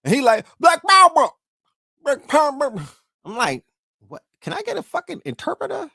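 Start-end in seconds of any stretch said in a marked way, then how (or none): 0.6-2.7 loud, high, garbled mock rally shout; mock slurred speech. Mocking a film actor's opening rally speech, garbled shout. 4.1-5.9 high-pitched, hammed-up confusion; mock bafflement. Viewer reacting to an unintelligible actor, mock baffled.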